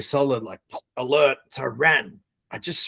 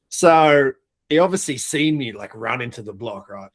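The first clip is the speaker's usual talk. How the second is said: ordinary delivery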